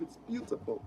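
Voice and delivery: put-on whispered English accent, awestruck; very soft, put-on English accent. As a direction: whisper it like a hushed English villain. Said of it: "I'll be with an English accent".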